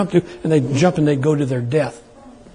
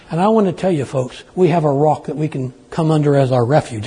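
ordinary delivery

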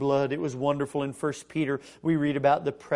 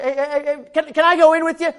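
acted pleading; 11 semitones higher, 10 dB louder than usual. Acts out pleading to enter.

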